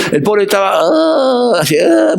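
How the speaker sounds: mock dog whimper. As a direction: imitate a dying dog's whine. Pastor imitates his sick dog. (gemido de perro enfermo fingido)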